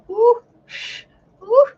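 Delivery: high, loud ooh of cold shock; mock shivery ooh. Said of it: "I'm like".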